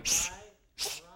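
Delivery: two separate hushing sounds; shushing. Acted. Crowd and doubts silencing the one in the storm, shushing. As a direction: shush twice to silence them. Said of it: "is saying to you".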